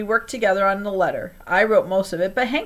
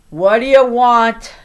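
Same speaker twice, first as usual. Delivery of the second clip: put-on slow, louder, grumpy old motel manager; a light hint of a frowning raspy old man; grumbling at an unwelcome kid; 3 semitones higher, 9 dB louder, slower than usual. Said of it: "an old guy with a raspy voice".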